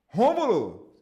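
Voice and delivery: "Rômulo!" shouted out high and loud; energetic greeting. Shown as a demonstration. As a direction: throw the name up with energy and lift the call. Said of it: "com essa entonação". ("Rômulo!" bem alto e animado, lá pra cima)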